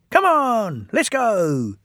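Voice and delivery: high, keen little engines; eager. Acted. The twins urging each other, high and eager. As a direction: rush off keenly. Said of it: "they said eagerly".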